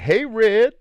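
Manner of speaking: put-on high, loud call of an old lady. Old woman calling to a young man, high and loud. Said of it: "And she's like".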